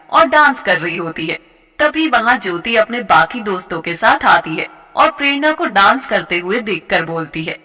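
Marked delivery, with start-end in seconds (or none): none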